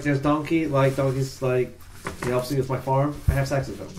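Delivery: usual speaking voice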